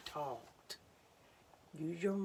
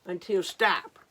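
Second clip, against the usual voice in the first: louder stop; emphatic stop; 9 dB louder than usual. A narrator's reading. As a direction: land stop hard. Rereading the stop.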